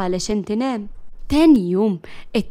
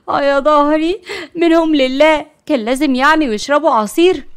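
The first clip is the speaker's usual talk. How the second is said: loud, raised-pitch aching complaint; weary groaning; 4 semitones higher, 5 dB louder than usual. A narrator's reading. Layla sits exhausted after dragging the cousins, groaning complaint. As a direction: moan about a sore back. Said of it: "قاعدين على الأرض بتعب".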